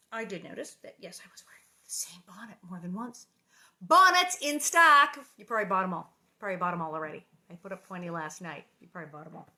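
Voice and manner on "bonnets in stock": loud, high, drawn-out sales cry; calling out wares. Pretend. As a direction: holler it like a street vendor. Calls out her merchandise like vendor.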